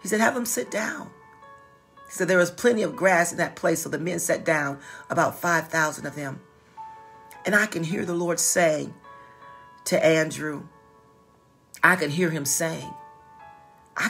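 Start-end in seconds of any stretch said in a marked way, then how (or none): none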